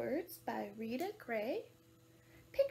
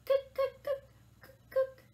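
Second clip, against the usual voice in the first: high, loud staccato bird call; imitating a woodpecker in sharp bursts; 11 semitones higher, 11 dB louder than usual. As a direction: rap out short woodpecker cries. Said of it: "Wanna make a woodpecker noise?".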